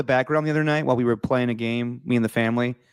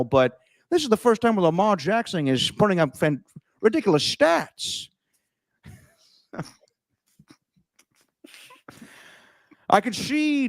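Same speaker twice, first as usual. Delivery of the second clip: swooping, slushy put-on movie-star voice; Connery-Stewart mix. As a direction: push through a terrible celebrity impression. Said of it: "Yeah, that was terrible".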